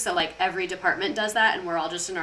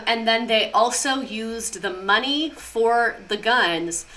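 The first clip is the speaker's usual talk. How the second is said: ordinary delivery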